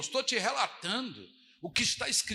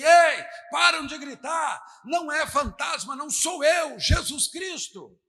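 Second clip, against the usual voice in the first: loud, slow commanding call; 5 semitones higher, 7 dB louder, slower than usual. Retold or imitated. Jesus calling to the frightened disciples, loud slow commanding shout. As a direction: call out over the storm. Jesus calls over the storm.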